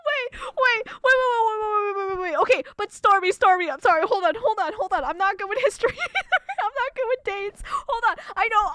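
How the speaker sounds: loud, high, frantic self-defence; flustered pleading. Real, not acted. Streamer begging chat to hold on, flustered and loud.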